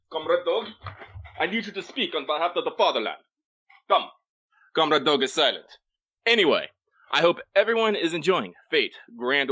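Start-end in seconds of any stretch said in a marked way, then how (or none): 0.1-5.7 hammed-up Soviet comrade in a wobbly Russian accent; bad Russian accent that drifts toward Italian; playful Russian accent, calling on the comrade dog; mock Russian accent. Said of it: "my attempts for a Russian accent is Italian".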